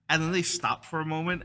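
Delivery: ordinary delivery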